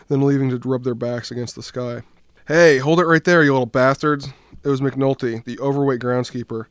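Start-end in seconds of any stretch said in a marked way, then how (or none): 2.5-4.3 loud, high yell across a field; angry yell. Acted. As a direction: bellow at the kids to stop. Groundskeeper yells at boys.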